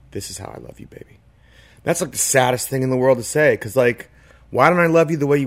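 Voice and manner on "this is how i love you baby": put-on much softer, almost dying out on baby; resigned fade. Lover repeating his answer to his partner, fading out quietly. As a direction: let the repeat fade to nearly nothing. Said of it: "it's a sad and resigned the way he says it".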